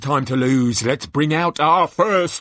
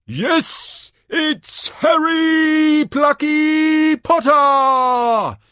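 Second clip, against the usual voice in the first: very high, loud drawn-out name reveal; ecstatic announcer shout; 9 dB louder, much slower than usual. Acted. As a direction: bellow each name slowly to a roaring crowd.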